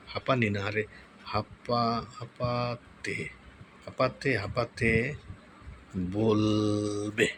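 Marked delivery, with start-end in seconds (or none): 1.2-7.3 halting, stretched syllables; slow dictation. Real, not acted. Tutorial host typing a prompt, slow halting dictation. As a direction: sound it out slowly as you type.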